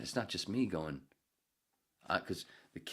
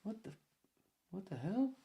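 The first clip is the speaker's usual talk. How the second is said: put-on much higher, puzzled child voice; imitating kids; 13 semitones higher than usual. Kids reacting mid-reading, puzzled child voice. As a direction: squeak it like a confused first grader. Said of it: "The kids are going".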